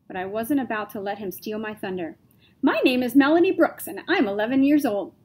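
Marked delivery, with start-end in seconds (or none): 2.6-5.1 loud, higher, evenly spoken device voice; Melody's speech machine introducing her on TV, loud and clear. A narrator's reading. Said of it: "my machine said loudly and clearly".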